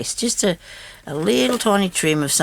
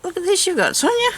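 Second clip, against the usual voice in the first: much higher, chiding herself; high self-talk. Retold or imitated. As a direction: squeak at herself playfully. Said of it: "'Cause I thought".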